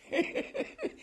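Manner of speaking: high, soft giggling laugh; quiet run of amused chuckles. Real, not acted. Investigator on accused officer drawing a pension, chuckling.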